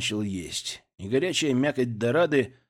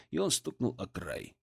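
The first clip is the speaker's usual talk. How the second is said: ordinary delivery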